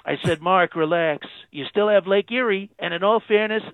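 channel a bragging president on the phone; Trump impression. President phoning into radio show, Trump-style boast.

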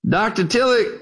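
a hint of an old preacher calling out, high. Old preacher interrupting a lecturer from the back, lightly acted. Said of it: "an old dark-skinned preacher".

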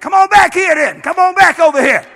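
loud, high call to come back; challenging yell across the parking lot; calling him back loud. Retold or imitated. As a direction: shout him back over. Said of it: "And I said".

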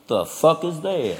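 slow, low, quiet Southern disbelief; small-town Southerner. Acted. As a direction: mumble it like a baffled Southern local. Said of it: "especially in the South".

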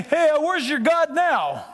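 drawn-out mocking taunt; slow sneer at the believer. Friends taunting.

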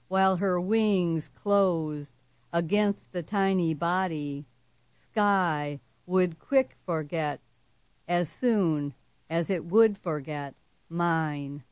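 slow, deliberate recital with pauses between phrases; poem reading. A narrator's reading. Poet closes her hummingbird poem before an audience, slow measured recital. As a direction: recite it gravely, stretching words and letting phrases fall. Reads own poem aloud.

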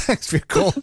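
real laugh breaking through, high. Real, not acted. Host laughing as he thanks the chef caller, high and bubbly.

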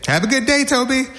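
high singsong mom send-off; cheery mom. Acted. Mom to son at the school bus, cheery. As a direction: call it out high like a doting mother.

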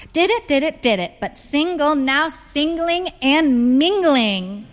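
high, lilting mother ticking things off; sing-song. A narrator's reading. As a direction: chant it playfully, stretching the last words. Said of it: "she said in a sing-song voice".

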